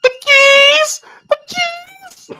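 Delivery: very high, drawn-out cry; mock-frantic yell at the screen; calling out. Watcher warning the girl about keys, high cry.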